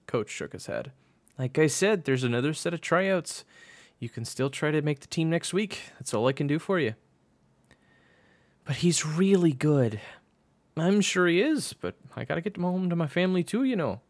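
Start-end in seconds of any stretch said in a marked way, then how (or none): 1.4-6.9 high, raised coach voice; firm coach. Acted. Coach refuses June a tryout, firm high voice. 8.6-10.0 high, loud, slow plea; insistent pleading. A narrator's reading. June argues for her friend, insistent pleading. 10.8-14.0 high, raised coach voice; dismissive coach. Acted. Coach wants to get home, dismissive high voice.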